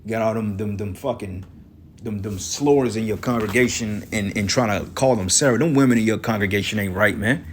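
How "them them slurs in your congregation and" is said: ordinary delivery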